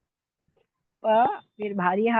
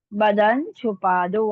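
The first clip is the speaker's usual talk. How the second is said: slow dictation; 4 dB louder, much slower than usual. Shown as a demonstration. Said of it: "अभी देखिए".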